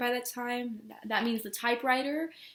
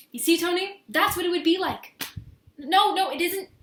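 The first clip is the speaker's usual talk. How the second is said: higher, loud and agitated; agitated insistence; 5 semitones higher, 7 dB louder than usual. Acted. To Tony after her family interrupts, agitated. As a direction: press the point home, upset.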